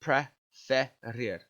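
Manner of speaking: "Preferir" said very slowly, each syllable clear; slow syllable demo. Shown as a demonstration. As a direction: stretch out "Preferir" for the class. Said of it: "that's the one that gets the stem change".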